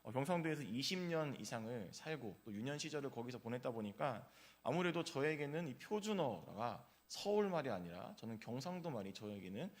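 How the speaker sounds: normal talking voice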